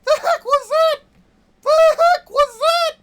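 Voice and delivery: very high, loud outraged shriek; frustrated screaming. Real, not acted. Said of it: "Sorry that I'm yelling".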